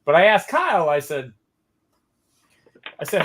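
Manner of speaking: normal talking voice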